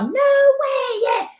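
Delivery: high-pitched imitation of someone yelling; mock yelling. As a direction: squeal it out as a mock yell. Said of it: "He was yelling".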